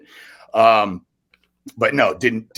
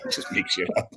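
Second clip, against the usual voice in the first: laughing. Real, not acted. Laughing while confessing his slacking.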